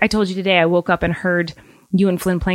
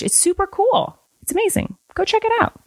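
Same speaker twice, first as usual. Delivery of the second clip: bright upbeat sales voice; high excited pitch; 12 semitones higher than usual. Acted. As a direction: sell it, pitch way up. Enthusiastic ad pitch.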